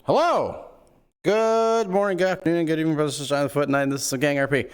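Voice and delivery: high, loud, showy welcome to the stream; stream host intro. Acted. Host opening the Gang RP stream, hyped greeting.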